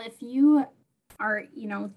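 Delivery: ordinary talk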